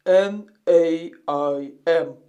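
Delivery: ordinary talk, nothing marked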